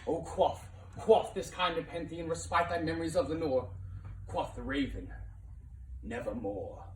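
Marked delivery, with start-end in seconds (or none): none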